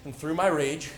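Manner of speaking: ordinary delivery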